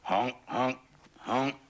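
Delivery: high, loud honking; goose honk. Acted. Goose honking, not a car, loud high honks. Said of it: "No, that's not a car".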